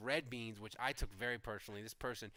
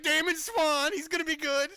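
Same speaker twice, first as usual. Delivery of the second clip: higher, louder, half-joking fan hype; mock hopeful hype; 14 semitones higher, 12 dB louder than usual. Fans desperate for a corner, hyping a rookie in mock excitement. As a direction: ham up the desperate fans' optimism. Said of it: "We're like".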